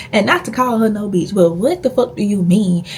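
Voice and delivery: ordinary talk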